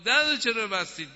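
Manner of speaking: calling through the door. Acted. Husband to wife behind a shut door, calling out.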